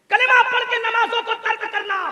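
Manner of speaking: high, ringing preacher's accusation; sudden high denunciation; accusing cry. Real, not acted. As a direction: cry out the charge to the crowd. Preacher cries out the charge.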